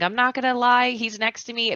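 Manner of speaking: usual speaking voice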